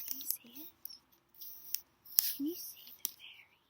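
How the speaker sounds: hushed, quiet nature-spotting voice; wildlife watching whisper. Acted. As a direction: whisper so you don't scare them. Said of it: "gotta be super duper quiet".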